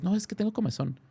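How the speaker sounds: a hint of the woman begging to be let go; pleading woman. Voicing the held woman asking to scratch, light pleading.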